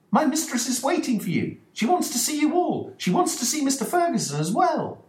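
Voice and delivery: put-on a young maid calling everyone upstairs; maid's voice. Dolores the maid eagerly summoning the gentlemen, high voice.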